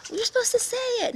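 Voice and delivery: very high, fast nudge; impatient trickster. Acted. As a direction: hurry her to say the words. Spider frustrated, rushing her.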